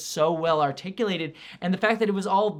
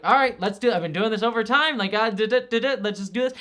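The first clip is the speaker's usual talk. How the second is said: imitating a breezy, resigned go-ahead, higher and louder; weary resignation; 5 semitones higher, 7 dB louder, faster than usual. Reviewer imitating Loki's resigned, unbothered delivery, light and weary. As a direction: toss it off like a chore done a thousand times. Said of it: "he's not exasperated. He's not, he's not angry".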